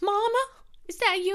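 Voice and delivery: high-pitched, frightened question; high scared voice. Retold or imitated. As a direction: call out high and unsure. Voicing the character seeing mum.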